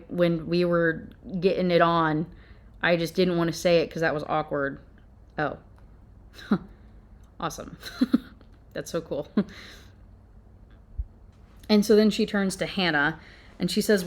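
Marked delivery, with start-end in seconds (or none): 5.4-9.4 sarcastic laugh. Real, not acted. Sarcastic reaction to twist.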